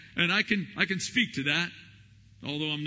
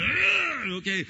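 pained groaning plea; 7 semitones higher, 5 dB louder than usual. Retold or imitated. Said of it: "I go to bed".

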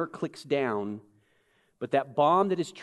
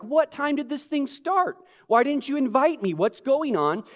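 high-pitched mock indignation; indignant latecomers; 9 semitones higher, 4 dB louder than usual. Latecomers to the party demanding answers, mock indignant. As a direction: complain in a higher voice, like a slighted guest. Acted late arrivals complaining.

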